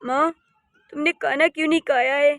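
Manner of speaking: normal talking voice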